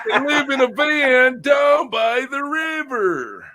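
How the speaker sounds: high, loud and slow, a mock motivational-speaker yell; a put-on comic shout at the chat; hammed-up shout. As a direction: bellow it like an over-the-top speaker. Quoted comedy line, shouted.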